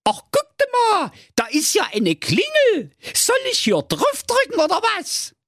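put-on high, cocky little boy. Däumling spots a doorbell.

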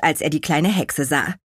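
usual speaking voice

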